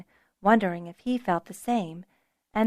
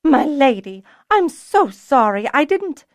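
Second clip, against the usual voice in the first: gasped apology; 6 semitones higher, 8 dB louder than usual. A narrator's reading. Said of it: "found breath enough to gasp".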